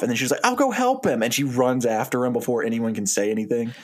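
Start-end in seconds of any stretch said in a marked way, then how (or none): 0.4-1.2 high, eager volunteering; eager offer. Acted. Wife volunteering to run after him, high eager offer. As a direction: jump in a little too keenly, pitched up.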